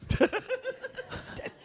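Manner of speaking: rire haut perché et bref; éclater d'un rire aigu. Real, not acted. Rire après le commentaire méchant. (éclat de rire aigu)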